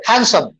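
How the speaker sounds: "हैंडसम" as HAN-sum, no D; silent D. Shown as a demonstration. Teacher modelling for the class, "हैंडसम" with silent D. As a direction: drop the D from "हैंडसम". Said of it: "डी खत्म कर दो".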